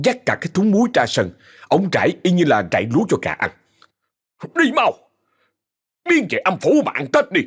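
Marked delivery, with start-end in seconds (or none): 4.6-7.4 shooing shout. Acted. Old man chasing ghost away.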